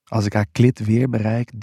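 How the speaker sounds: usual speaking voice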